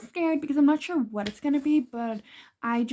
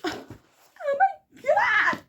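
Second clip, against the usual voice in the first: high shrieking oh my god; excited shriek; much slower than usual. Real, not acted. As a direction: squeal it out in shock. Sudden shriek over book moment.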